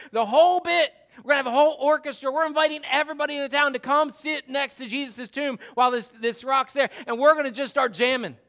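usual speaking voice

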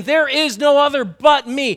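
high, loud proclamation; loud, insistent claim of being the only one; emphatic declaration. Acted. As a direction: declare it forcefully, hitting each word. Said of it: "I am emphatically saying".

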